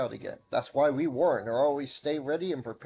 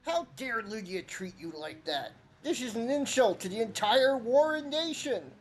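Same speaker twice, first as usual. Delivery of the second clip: much higher character voice; indignant rabbit villager, voice pitched up; high-voiced villager; 8 semitones higher than usual. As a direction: play the indignant elder, pitch up. Voicing game villagers.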